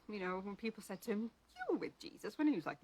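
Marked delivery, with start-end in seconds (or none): 1.6-2.4 high-pitched retold accusation; mock accusation. People challenging Peter about Jesus, high mock accusation. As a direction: pitch it up as a bystander pointing Peter out.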